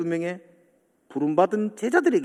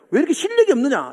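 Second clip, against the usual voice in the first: scolding; 6 semitones higher, 3 dB louder, faster than usual. Retold or imitated. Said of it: "이렇게 말했으면은".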